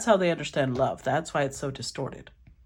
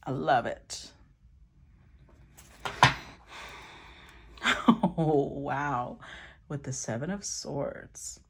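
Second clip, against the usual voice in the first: ordinary talk, nothing marked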